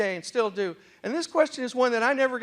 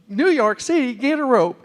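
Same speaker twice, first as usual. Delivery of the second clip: mock commercial quote; 4 semitones higher, 8 dB louder than usual. Imitates Texans quoting the commercial.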